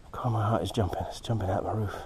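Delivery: hushed, breathy whisper of excitement; thrilled whisper. Real, not acted. Whispering to the viewers about a pounding heart. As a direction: let it out as a whisper. Thrilled over the Moldavite find.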